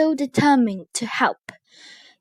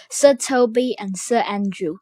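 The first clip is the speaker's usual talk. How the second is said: ordinary delivery, nothing marked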